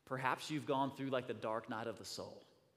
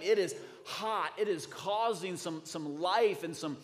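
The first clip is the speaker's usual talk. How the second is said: louder and higher, building sermon fervor; full-force preaching; 6 semitones higher, 9 dB louder than usual. Real, not acted. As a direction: preach it hard to the crowd.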